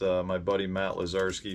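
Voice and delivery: ordinary talk, nothing marked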